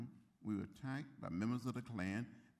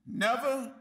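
loud, high cry of NEVER; Never ringing out like a preacher's cry; shouted plea; 10 semitones higher, 13 dB louder than usual. Real, not acted. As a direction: call it out to the whole hall. Emphatic repeated plea.

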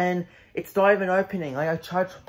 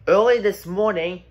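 ordinary delivery

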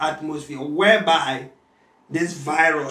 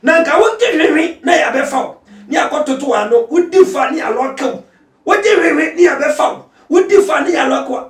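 loud, high preaching shout; preaching at full force; 9 semitones higher, 11 dB louder than usual. Acted. Pastor exhorts listeners about social media, full-force preaching. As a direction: pastor building to a fervent shout.

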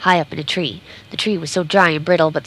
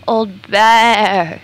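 loud, high call for help; crying out; 4 semitones higher, 7 dB louder than usual. A narrator's reading. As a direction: call to him across the hillside in alarm. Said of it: "she cried".

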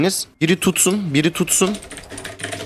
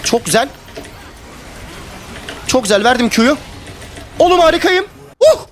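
excited cheering; 9 semitones higher than usual. Real, not acted. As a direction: hype yourself up, building to a shout. Player pulling off a great play.

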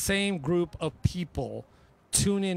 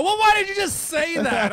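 exasperated outburst; 10 semitones higher, 6 dB louder than usual. Retold or imitated. Retold exasperation.

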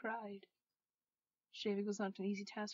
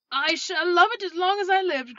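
high, very loud burst of delight; elated, ringing exclamation; joyful cry; 8 semitones higher, 17 dB louder than usual. Acted. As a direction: cry it out with overflowing joy. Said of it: "cried Scrooge".

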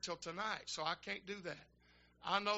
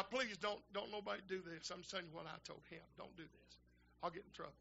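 hushed aside; 8 dB quieter than usual. Real, not acted. Aside telling congregation not to.

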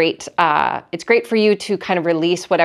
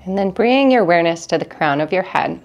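calm, measured meditation guide with long pauses; guided meditation; 2 semitones lower than usual. Acted. Teacher leads listeners through breathing in an energy cleanse, calm guided meditation. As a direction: guide them gently through each breath, unhurried.

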